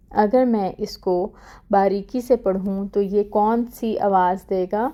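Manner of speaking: ordinary talk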